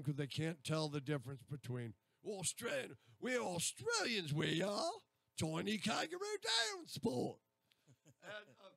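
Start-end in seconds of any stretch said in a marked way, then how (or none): 2.2-7.4 slow, high, hammed-up Australian accent; exaggerated Aussie accent impression. Shown as a demonstration. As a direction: ham up an Australian accent, climbing in pitch. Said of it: "and then we get Australian".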